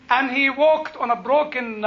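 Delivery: normal talking voice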